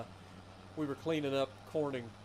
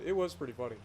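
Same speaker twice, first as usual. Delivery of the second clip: ordinary talk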